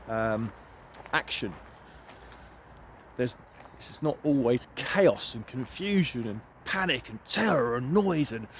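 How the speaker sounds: ordinary delivery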